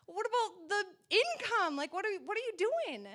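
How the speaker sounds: high-pitched retold protest; exasperated pleading. Homeschool mom questioning the Lord about income, exasperated pleading. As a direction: demand answers, half playful and exasperated.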